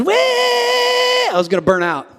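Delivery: high shouted cry of rushing; hammed-up panic shout; frantic shout. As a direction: yell it like you're about to crash. Acting out frantic rush.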